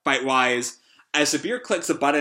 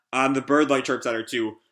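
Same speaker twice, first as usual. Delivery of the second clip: usual speaking voice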